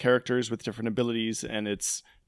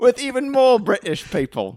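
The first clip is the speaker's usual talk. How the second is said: higher, hammed-up British announcer; over-the-top fake British accent; mock British accent; 11 semitones higher, 5 dB louder than usual. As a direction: announce it like a bad British presenter. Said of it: "terrible accents".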